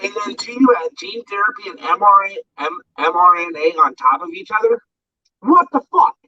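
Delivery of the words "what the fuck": sudden higher, louder outburst; stunned outrage. Real, not acted. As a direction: blurt it out in disbelief. Outraged disbelief at vaccine claim.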